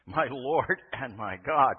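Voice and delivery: slow, loud, wonder-struck; awed confession. Retold or imitated. Thomas on his knees before Jesus, slow awed cry. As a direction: breathe it out in slow awe. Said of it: "just looking up at the Lord and saying".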